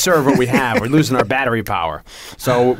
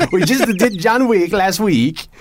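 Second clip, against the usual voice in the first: put-on Swedish accent; 8 semitones higher, slower than usual. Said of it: "never tried to do a Swedish accent".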